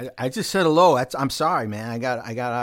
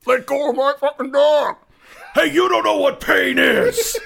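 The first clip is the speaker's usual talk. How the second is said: angry shout; 12 semitones higher, 8 dB louder than usual. Acted. Shouted movie quotes.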